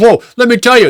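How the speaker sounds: high, loud mock telling-off; mock laying into him. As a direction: imitate a woman launching into a tirade. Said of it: "she starts just laying into him".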